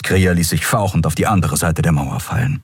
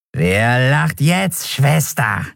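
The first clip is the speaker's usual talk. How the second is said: high, very slow creature voice; gloating skeleton; 6 semitones higher, much slower than usual. A narrator's reading. Skeleton taunts captured Endriel, slow gloating high voice.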